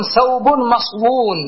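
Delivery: "مصون" drawn out as maṣwūn after "ثوب"; uncontracted form quoted. Shown as a demonstration. Teacher quoting the Arabs' usage, "مصون" slowly as maṣwūn. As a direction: slowly sound the w in "مصون", maṣ-wūūn. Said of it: "يقولون".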